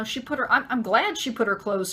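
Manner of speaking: normal talking voice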